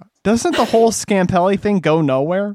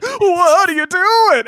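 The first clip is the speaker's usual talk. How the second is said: high slow mock alarm; 14 semitones higher, 4 dB louder, slower than usual. Characters voiced.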